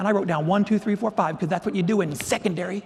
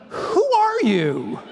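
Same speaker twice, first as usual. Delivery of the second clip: drawn-out, exaggerated bewilderment; baffled outburst; 9 semitones higher, much slower than usual. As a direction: stretch it out in mock disbelief. Puzzled outburst at unknown caller.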